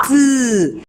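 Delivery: "T" as a short voiceless tongue tap with no vowel; only the tap of "T" behind the teeth; bare T sound. Shown as a demonstration. Teacher leading the class in the bare T sound. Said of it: "Hagámoslo, niños".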